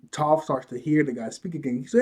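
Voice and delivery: ordinary delivery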